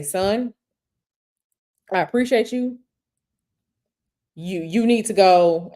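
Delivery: firm, direct, no-nonsense. Shown as a demonstration. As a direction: tell him straight, firmly. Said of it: "you have to have that tone with him".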